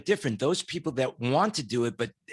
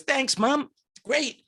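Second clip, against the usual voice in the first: put-on chirpy kid; 10 semitones higher, slower than usual. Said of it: "And Johnny says".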